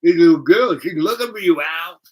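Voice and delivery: high, loud sing-out through a grin; loud, high imitation of a grinning man; grinning man's voice. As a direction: call it out high and loud, teasing. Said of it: "he's got this little grin".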